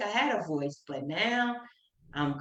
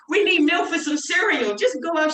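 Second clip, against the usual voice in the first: high and loud, sending her off; teasing imitation of a bossy mom; mock pointed mother; 11 semitones higher, 7 dB louder than usual. Mother sending stressed daughter out for milk, mock high and loud. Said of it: "She used to be like".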